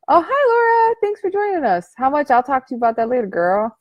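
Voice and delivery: high, fake-sweet Nelly voice; sweet-talking inner critic, high and chirpy; sugary inner critic. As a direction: play the sly friendly nemesis. Voicing negative Nelly character.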